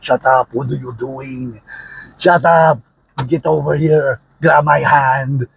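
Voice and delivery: a half-held-back Austrian strongman shout; Arnold Schwarzenegger impression. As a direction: bark orders in an Austrian movie-star accent. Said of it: "I'm not doing the best Arnold".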